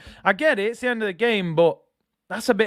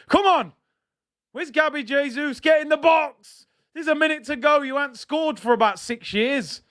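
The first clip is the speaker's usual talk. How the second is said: urging shout; 8 semitones higher than usual. Real, not acted. Fan urges Gabby Jesus to score, shouting. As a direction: get in the box, shout it at the screen.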